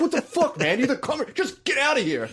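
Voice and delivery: high-pitched mock rage of a boss; hammed-up angry outburst, voice cracking upward in disbelief; furious. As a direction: imitate someone losing it, pitch shooting right up. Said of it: "He was furious".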